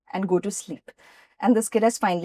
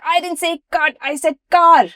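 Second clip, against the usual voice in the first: put-on fuming accented director; 7 semitones higher, 6 dB louder than usual. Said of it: "in his accent, car and cut sound very similar".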